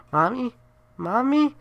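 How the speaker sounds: put-on high eerie child call; creepy child voice. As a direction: call out like a lost ghost child. Ghost child calls out.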